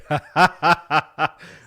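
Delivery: breaks into laughter after the bad accent; real laugh at his own joke. Real, not acted.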